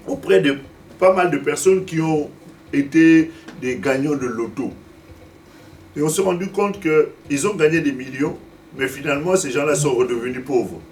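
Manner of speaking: ordinary delivery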